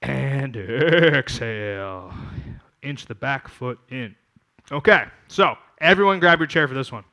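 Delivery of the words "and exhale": slow, drawn-out breathing cue; slow exhale cue. Shown as a demonstration. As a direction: guide the out-breath slowly. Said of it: "breathing cue".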